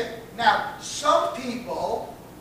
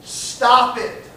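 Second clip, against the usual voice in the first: stern command; 4 semitones higher, 7 dB louder, much slower than usual. Shown as a demonstration. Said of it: "Here's my solution".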